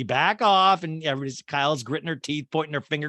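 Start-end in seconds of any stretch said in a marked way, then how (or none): 0.0-0.8 mock command. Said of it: "she goes".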